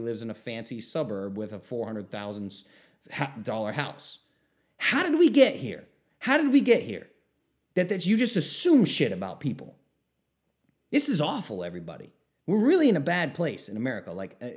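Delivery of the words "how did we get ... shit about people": much higher and louder, incredulous; exasperated outcry. Real, not acted. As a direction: throw your hands up at it. Exasperated about people's assumptions.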